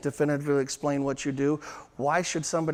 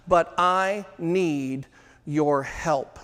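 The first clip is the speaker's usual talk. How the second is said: slow plea; much slower than usual. Shown as a demonstration. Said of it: "Most powerful words in selling".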